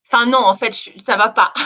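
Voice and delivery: loud nervous laugh through the words. Real, not acted. Telling her guest she is not okay, laughing it off loudly. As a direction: laugh off the bad news.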